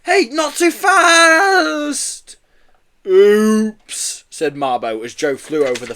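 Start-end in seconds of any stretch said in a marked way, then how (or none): 0.0-2.3 very high, loud cry stretched out long; panicked shout. A narrator's reading. Boy being flung over the fence. 3.1-4.2 loud, high, drawn-out oops; big creature's clumsy slip; sheepish oops. A narrator's reading. Yeti threw the boy too far.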